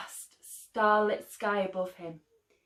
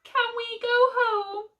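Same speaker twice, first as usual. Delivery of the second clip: put-on much higher, small plaintive baby voice; 14 semitones higher, 3 dB louder than usual. A narrator's reading. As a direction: ask it like a tired little child. Baby character asks plaintively.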